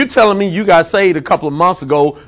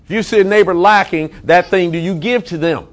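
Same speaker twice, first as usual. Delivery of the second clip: ordinary delivery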